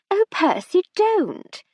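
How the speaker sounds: a shocked wife reining in her husband; wife rebukes tipsy Percy; scolding wife. A narrator's reading.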